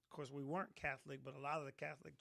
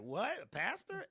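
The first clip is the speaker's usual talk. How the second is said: surprised; 7 semitones higher, 5 dB louder than usual. Retold or imitated. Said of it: "everybody's like".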